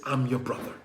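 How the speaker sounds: soft, broken near-whisper; tearful hushed reveal. Acted. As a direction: hush it out through tears. Yusuf reveals himself, weeping.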